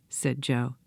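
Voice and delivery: ordinary delivery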